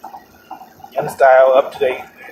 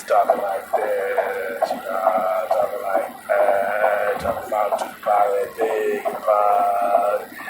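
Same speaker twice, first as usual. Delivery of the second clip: lower, soft, slow draggy tired voice; a tired dragging rumble; 5 semitones lower, 5 dB quieter, much slower than usual. Retold or imitated. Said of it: "in that same draggy, tired, rumbly voice".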